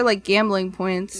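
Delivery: normal talking voice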